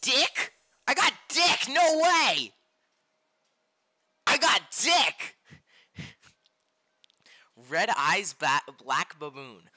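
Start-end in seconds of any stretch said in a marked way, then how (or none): 0.0-5.3 high, loud gleeful shout; excited shouting. Real, not acted. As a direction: yell in disbelief at the prize. Gets a rare card.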